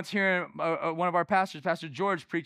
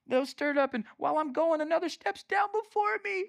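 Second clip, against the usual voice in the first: high, self-pitying whine; mock victim; 10 semitones higher than usual. Preacher mimicking the man by the pool, mock self-pity. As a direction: whine it as the poor victim, pitched way up. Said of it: "I'm a victim".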